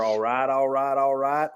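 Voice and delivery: light American Southern drawl, lightly done; a hint of the laid-back Texan catchphrase; Matthew McConaughey impression. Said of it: "Matthew McConaughey".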